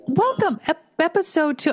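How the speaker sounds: high, grand welcome; a showy episode welcome; big welcome. Shown as a demonstration. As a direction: announce it with fanfare. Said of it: "Wait, do it again".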